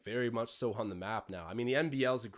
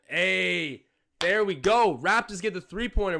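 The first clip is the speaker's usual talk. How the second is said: louder and higher, the "hey" stretched slowly; excited cheer; 6 semitones higher, 9 dB louder, slower than usual. Real, not acted. Streamer cheering the Raptors three-pointer, excited shout. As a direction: cheer the shot going in as a fan.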